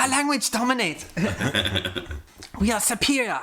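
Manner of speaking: hammed-up superiority. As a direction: boast like a mock overlord, slightly high. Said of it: "just being like".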